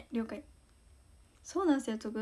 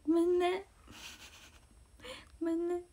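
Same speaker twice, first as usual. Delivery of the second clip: cutesy sorry; 5 semitones higher than usual. Real, not acted. Teasing apology to fan.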